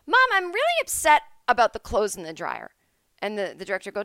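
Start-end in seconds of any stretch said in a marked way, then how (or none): 0.0-2.8 high, loud, overdone upset; overacted line. Shown as a demonstration. Actor overplaying a line about laundry to mom, hammy and loud. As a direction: ham it up like a bad actor. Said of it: "people do too much with the acting".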